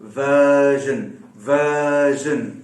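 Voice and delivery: वर्जन with a long drawn-out first vowel and no R; teacher stretching वर्जन; stretched vowel. Shown as a demonstration. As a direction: pull the vowel of वर्जन out slowly. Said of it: "मैंने खींचा वर्जन".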